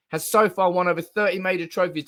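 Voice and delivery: usual speaking voice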